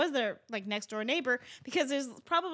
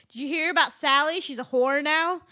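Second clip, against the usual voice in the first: louder, eager gossip voice; gossiping neighbor; 4 semitones higher, 8 dB louder than usual. Retold or imitated. Neighbor dropping by with juicy gossip, loud eager voice. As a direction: dish the dirt like a porch busybody. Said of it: "your neighbor will come by and be like".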